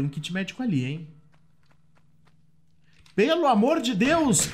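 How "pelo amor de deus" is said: startled exclamation. Real, not acted. Susto com inimigos no jogo.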